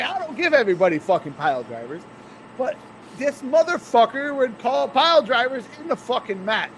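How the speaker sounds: usual speaking voice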